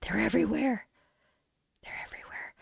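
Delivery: whispered aside, all breath; voiceless mock-alarmed whisper; hushed whisper. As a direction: whisper it like a secret warning. Whispered aside about the turkeys.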